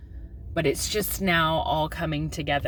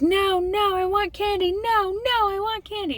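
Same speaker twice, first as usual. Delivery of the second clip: put-on high, whiny little-girl voice; imitating whiny daughter; 3 dB louder than usual. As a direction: mimic a kid begging for candy. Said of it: "And she's like".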